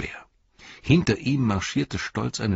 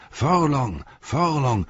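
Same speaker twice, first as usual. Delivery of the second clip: higher, louder chant of the name; crowd calling; 9 semitones higher, 4 dB louder, slower than usual. A narrator's reading. Crowd hails the lord Forlong, calling out loud. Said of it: "hörte Pippin sie rufen".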